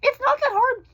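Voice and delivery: high squealing outburst; exasperated squeal. Real, not acted. Vlogger venting at adults forcing pills, squealing.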